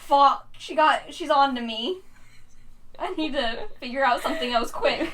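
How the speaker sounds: comic inner panic; mock panic. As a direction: act out being found out, half laughing. Telling own panicked inner reaction.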